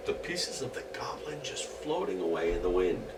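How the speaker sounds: soft whisper, fading. A narrator's reading. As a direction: let the pieces drift off in a hush. Goblin blown away.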